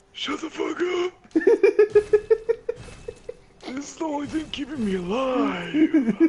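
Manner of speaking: put-on high-pitched old patient's wheeze; wheezing. Ailing gamer answering the doctor, high wheezing protest. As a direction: squeeze it out high, as a wheezing invalid. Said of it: "and I wheeze".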